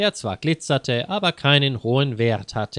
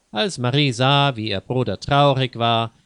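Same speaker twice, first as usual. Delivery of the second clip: usual speaking voice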